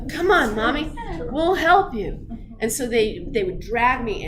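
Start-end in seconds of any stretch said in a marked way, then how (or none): 0.1-2.3 put-on kids cheering. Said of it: "the boys would be like".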